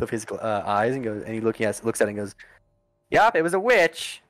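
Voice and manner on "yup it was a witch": high, put-on character voice; raised pitch, confident pronouncement; doctor's verdict. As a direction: announce it as the eccentric doctor. Doctor announces verdict after inspection.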